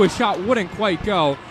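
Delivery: normal talking voice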